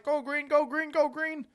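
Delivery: high-pitched mock cheering chant; mock screaming. Roommate screaming at frog racing, high mock cheer. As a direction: imitate a gambler screaming at a race. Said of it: "he's screaming in the living room".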